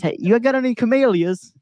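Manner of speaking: put-on terrible British accent. Retelling the British customer's camellia question, in a badly faked accent. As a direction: imitate a British customer in a high, bad accent. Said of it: "That's a terrible accent".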